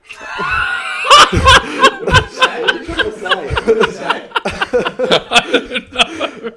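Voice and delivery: loud, high-pitched burst of laughter; real helpless cackling; laughing fit. Real, not acted. Mates cracking up at a fumbled prediction, helpless laughter.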